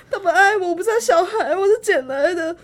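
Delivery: hammed-up high crying wail, half laughing; high mock sob. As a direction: wail it like a wronged child, laughing through it. Mock-wailing that mom hates her.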